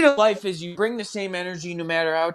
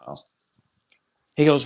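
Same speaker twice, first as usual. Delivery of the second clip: ordinary talk, nothing marked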